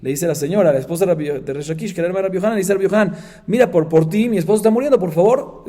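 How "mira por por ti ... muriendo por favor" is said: raised, urgent mock pleading; imitated tearful plea. Wife begging her brother for dying husband, imitated pleading. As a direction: plead for help, without real sobs. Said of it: "fue llorando".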